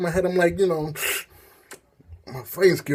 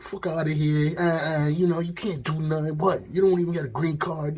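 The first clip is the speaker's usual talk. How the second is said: higher-pitched mock trash talk; 4 semitones higher than usual. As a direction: show off with taunting, dismissive bluster. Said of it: "I started talking shit like".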